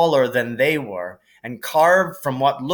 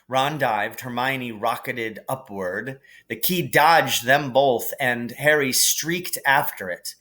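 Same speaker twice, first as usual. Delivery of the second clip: ordinary delivery, nothing marked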